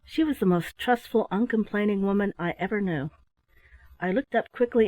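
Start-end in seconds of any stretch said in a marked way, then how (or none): none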